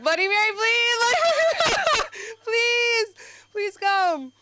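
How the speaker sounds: high, loud wailing plea to Bloody Mary; desperate pleading summons. Retold or imitated. Mom summoning Bloody Mary, shrill crying plea. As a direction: beg her to come, voice cracking. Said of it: "You're like".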